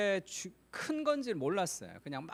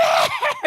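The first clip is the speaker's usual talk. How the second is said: high scream; 10 dB louder than usual. Retold or imitated. Wife screaming in the video.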